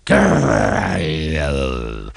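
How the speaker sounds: low long growl; deep mock engine roar. A narrator's reading. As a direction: growl in frustration. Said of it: "roared Diesel".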